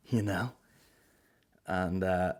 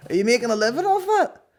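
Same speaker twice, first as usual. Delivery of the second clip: even higher, louder sneering bloke; ruder repeat; 14 semitones higher, 6 dB louder than usual. Retold or imitated. Comedian repeats the punter's rude question, higher and louder. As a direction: push the insult harder. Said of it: "Like, so rude".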